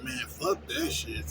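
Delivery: slow weary dismissal. Retold or imitated. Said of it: "So you be like".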